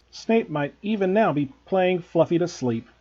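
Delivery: ordinary delivery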